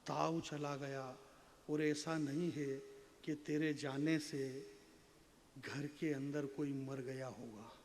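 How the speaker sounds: low, soft, measured verse delivery; poetry recitation. Acted. Poet reciting a runaway daughter poem to an audience, low and measured. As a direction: recite gravely, letting each phrase settle.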